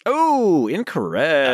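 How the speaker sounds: high, mock-sympathetic game-show rejection; hammed-up quiz host. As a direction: sing out the wrong-answer verdict in a high voice. Host rejects a wrong quiz answer.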